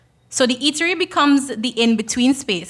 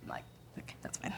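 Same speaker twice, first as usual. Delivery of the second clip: whispered aside; whispering; 26 dB quieter, much faster than usual. Real, not acted. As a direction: drop to a whisper. Aside to slide operator.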